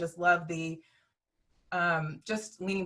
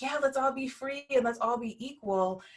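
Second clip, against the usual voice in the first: lightly voiced, higher-pitched dreamer; a touch of youthful rallying, pitched up; idealistic young activist; 6 semitones higher than usual. Speaker voicing the dream of young activists, lightly idealistic. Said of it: "the dream of, like".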